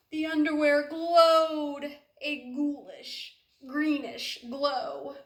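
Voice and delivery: slow, eerie, drawn-out spooky voice; spooky storytelling. A narrator's reading. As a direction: make it creep like a ghost story. Spooky refrain read aloud.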